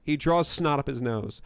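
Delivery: normal talking voice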